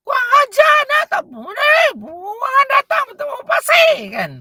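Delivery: put-on shrieking high, loud, furious yell at a child; full harsh yell; angry shouting. Shown as a demonstration. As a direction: scream at the naughty kid. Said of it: "Kita jerit-jerit".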